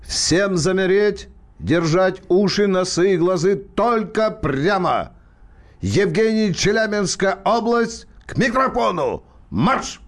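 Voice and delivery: barked parade-ground orders, slow and high; mock army commander calling to attention; drill sergeant commands.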